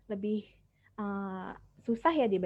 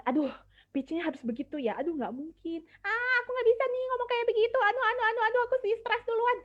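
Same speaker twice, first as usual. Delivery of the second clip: mock panic; 12 semitones higher, 5 dB louder, faster than usual. Mimics a nervous storyteller.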